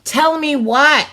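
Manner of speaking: loud, slow call across the room; yelled. A narrator's reading. Jenny yelling at Katie across the classroom, loud. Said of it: "Jenny yelled from across the room".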